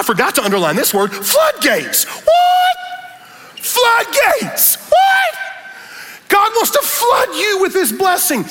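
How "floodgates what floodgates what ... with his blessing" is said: shrieked high preaching cry; preacher's shout. Acted. Pastor shouting floodgates and what back at the congregation. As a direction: scream the word to the rafters. Call and response peak.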